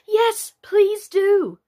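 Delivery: high, loud, drawn-out plea; chorus of pleading. A narrator's reading. The others joining Alice to beg the mouse, calling out. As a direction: call it out slowly like a whole crowd begging. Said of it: "all the others joined in chorus".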